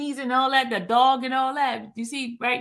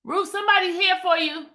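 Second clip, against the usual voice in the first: calling out; 9 semitones higher, faster than usual. Real, not acted. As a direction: call her from across the house. Calling someone from another room.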